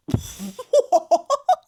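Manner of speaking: loud, high laughing fit; loud cackle of disbelief; real burst of laughter. Real, not acted.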